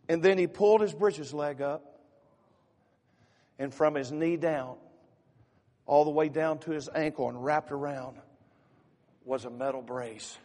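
loud, high, impassioned story telling from the pulpit; fervent sermon storytelling. Acted. Preacher to congregation, telling the puppy story with loud fervor. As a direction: preach the story out with rising force.

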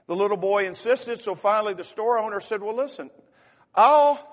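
loud, high, impassioned story telling from the pulpit; fervent sermon storytelling. Acted. Preacher to congregation, telling the puppy story with loud fervor. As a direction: preach the story out with rising force.

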